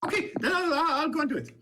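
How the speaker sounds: put-on high, eager young halfling guard boasting. Young halfling guard to the player, eager and high. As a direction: pitch it up like a keen young guard inviting a friend.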